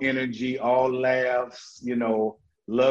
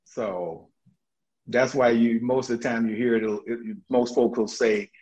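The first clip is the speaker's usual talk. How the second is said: ordinary talk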